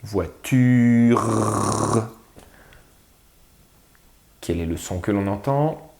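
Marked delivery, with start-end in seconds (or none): 0.0-2.1 "voiture" ending in a long rolling rasped r; final r drawn out. Shown as a demonstration. Teacher asking for the last sound, "voiture" with prolonged final r. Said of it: "qu'est-ce qu'on entend après U dans ture?".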